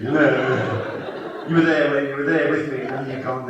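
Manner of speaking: ordinary talk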